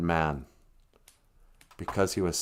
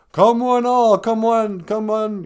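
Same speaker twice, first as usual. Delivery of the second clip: loud, high barker call; carnival barker; 11 semitones higher, 7 dB louder, slower than usual. Retold or imitated. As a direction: bark it out to the crowd. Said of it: "What do those guys say, those carnival barkers?".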